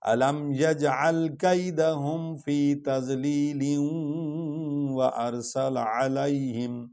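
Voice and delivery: slow, melodic chanted recitation; Quran recitation. Acted. Teacher recites the full surah for students. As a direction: chant the whole surah slowly, devotionally.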